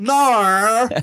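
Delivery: mock the Aussie "no" as "Nar"; mock Australian no. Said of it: "they say "ner".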